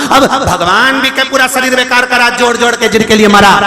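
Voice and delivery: high-pitched, driving sermon tirade; full-force preaching. Real, not acted. Preacher berating listeners for a life of hoarding, full-force preaching. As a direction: hammer the repeated words, building in pitch.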